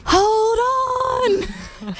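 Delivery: a high, shouted warning; yelling. Retold or imitated. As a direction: yell it out over the engine noise. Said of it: "the driver yells out to us".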